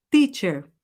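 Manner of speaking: teacher with a pure T, "tee"; correct teacher. Shown as a demonstration. Teacher modelled for students to repeat, with a pure T. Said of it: "De novo".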